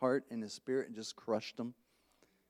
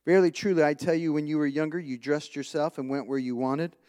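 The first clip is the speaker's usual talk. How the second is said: loud, forceful scripture reading from the pulpit; preaching scripture; 6 dB louder than usual. Acted. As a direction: proclaim it to the congregation, louder. Reads scripture in full preaching voice.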